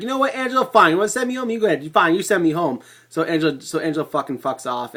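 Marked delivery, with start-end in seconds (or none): 0.0-2.8 high, indignant retort, pitch jumping up; defiant outburst. Retold or imitated. Phoebe snapping back at Angela, indignant and high. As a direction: tell her off and dare her to do it. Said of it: "Phoebe's like".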